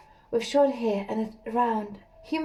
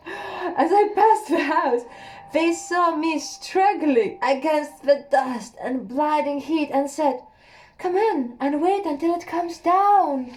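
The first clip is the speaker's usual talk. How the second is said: laughing through it; 5 semitones higher, 4 dB louder than usual. Real, not acted. Narrator laughing through the line.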